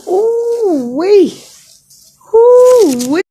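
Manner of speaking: high pained squeals of ouch; pained ooh wee. Real, not acted. Patient turning in hospital bed, high pained squeal. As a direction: squeal through the sudden pain.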